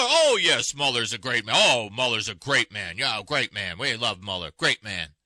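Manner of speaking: low, sarcastic parroting of loyal supporters; mock praise. Host mimics Republicans who support Mueller, low mock praise. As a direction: put on a deep, fawning politician's voice.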